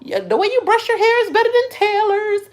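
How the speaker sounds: very high, gushing flattery; mock reassurance. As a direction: coo exaggerated compliments in a squeaky voice.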